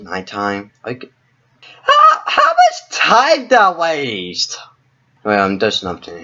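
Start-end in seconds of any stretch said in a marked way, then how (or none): 1.8-4.6 slow, high frustrated shout; exasperated shout. Real, not acted. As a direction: drag it out in disbelief. Exasperated at wasted time.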